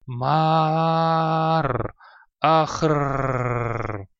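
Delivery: strained, pained shouts; agonized cries. Acted. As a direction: cry out in agony. Mage in agony.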